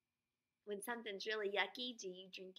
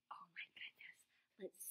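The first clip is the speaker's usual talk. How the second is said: conspiratorial whisper; teacher whispering to the class before a secret; 13 dB quieter, slower than usual. Acted. Teacher reveals secret recipe to class.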